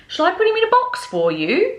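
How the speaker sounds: sales lady. Acted. Sales lady offering a box to the girl. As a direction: offer it helpfully. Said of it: "the sales lady asked".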